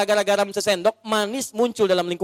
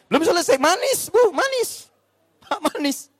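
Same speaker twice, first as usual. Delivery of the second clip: playful wife; 12 semitones higher than usual. Acted. Wife's voice, teasing sweetness.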